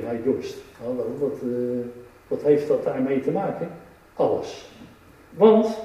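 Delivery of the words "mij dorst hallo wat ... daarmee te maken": much softer, lower puzzled aside; a soft, baffled aside to yourself; puzzled listener. Retold or imitated. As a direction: mutter it like a confused listener. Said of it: "Dan zou je zeggen".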